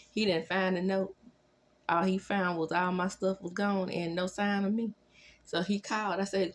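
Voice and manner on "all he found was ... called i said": ordinary delivery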